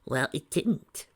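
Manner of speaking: slow, sharp retort; biting back. Acted. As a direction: snap it back, annoyed. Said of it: "Trixie bit back".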